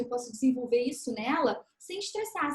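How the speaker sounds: ordinary delivery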